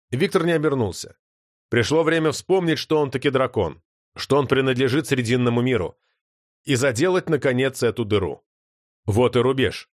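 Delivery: ordinary delivery, nothing marked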